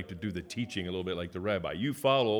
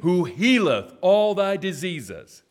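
high, loud proclaiming of scripture; preaching; 5 semitones higher, 5 dB louder than usual. Acted. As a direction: call out each verse for the church to echo. Leads responsive scripture reading.

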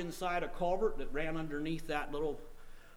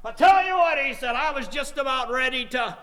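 loud, high outburst imitating a desperate man; preacher voicing a distraught man, raised and urgent; agitated retelling; 5 semitones higher, 6 dB louder than usual. Preacher retelling a man's suicide threat, agitated.

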